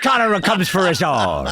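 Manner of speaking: a light Welsh pirate accent, hammed up; a hint of a Welsh pirate accent, theatrical. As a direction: play the Cholera Man as a mock Welsh pirate. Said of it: "this kind of Welsh pirate voice".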